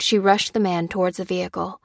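ordinary delivery

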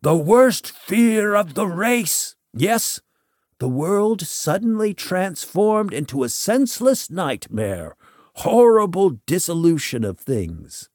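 high, impassioned proclamation; theatrical bellow. A narrator's reading. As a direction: declaim it like a mad prophet. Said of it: "pointed at me and bellowed".

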